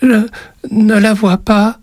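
put-on high, slow, halting child voice; barely manage it as a scared boy; struggling through fear. A narrator's reading. Boy tells witch he can't find it, fearful. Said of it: "réussit-il tout de même à dire".

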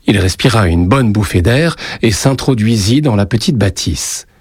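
usual speaking voice

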